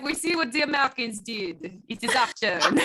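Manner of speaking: mock French accent, lightly done; a hammed-up French accent. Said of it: "I think I kinda nailed that".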